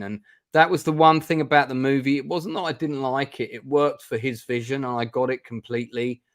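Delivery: normal talking voice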